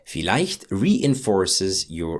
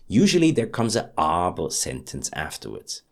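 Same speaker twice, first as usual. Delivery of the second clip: ordinary talk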